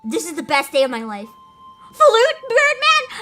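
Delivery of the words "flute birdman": high, slow, loud squeal; excited squeal. Real, not acted. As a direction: shriek with delight. Excited outburst.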